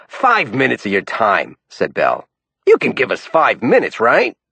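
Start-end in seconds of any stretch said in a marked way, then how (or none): 0.1-1.6 raspy New York man pushing; New York accent. A narrator's reading. Bell's dialogue. 2.7-4.4 raspy New York man pushing; New York accent. A narrator's reading. Bell's dialogue.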